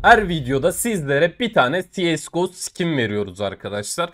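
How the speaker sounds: promo announcement. Acted. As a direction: announce the giveaway like an ad. Giveaway announcement at video start. (yüksek sesli, coşkulu duyuru)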